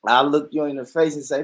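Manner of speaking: ordinary delivery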